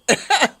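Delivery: loud burst of laughter. Real, not acted. Laughing at her own hiccups.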